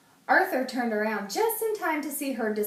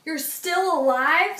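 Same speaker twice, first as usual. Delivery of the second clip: amazed; 7 semitones higher, 7 dB louder than usual. A narrator's reading. Francine amazed.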